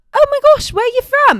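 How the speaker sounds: high, loud British excitement; imitated British accent. British stranger thinks he met a compatriot, excited high British accent. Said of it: "he doesn't have a British accent either".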